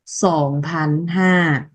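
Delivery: "Sòng phán ha" said slowly, syllable by syllable; slow Lao numeral. Shown as a demonstration. Teacher repeating "Sòng phán ha" for students, slow. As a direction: drill "Sòng phán ha" carefully for the class. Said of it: "đọc là".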